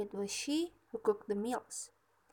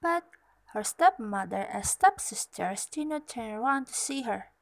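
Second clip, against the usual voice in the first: ordinary delivery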